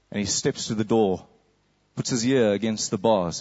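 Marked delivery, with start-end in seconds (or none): none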